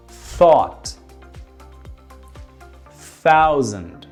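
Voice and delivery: each word slow and clearly separated; slow exaggerated speech modeling. Shown as a demonstration. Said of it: "I'm going to read you a couple of words".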